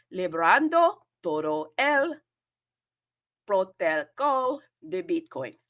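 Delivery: shy learner's Spanish ad read; attempted Spanish. Shown as a demonstration. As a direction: read the Spanish pitch line nervously. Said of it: "Do not laugh at me".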